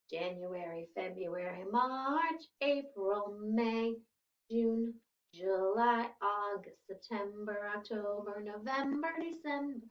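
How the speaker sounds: chanted list of months, rhythmic; sing song rote recitation. Shown as a demonstration. Teacher leads months recitation.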